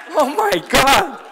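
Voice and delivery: loud, very high incredulous cry; hammed-up disbelief, voice shooting up high; mock exasperated outburst. Son shocked Mom asked for a miracle, mock high-pitched outburst. Mock outrage at mother's request.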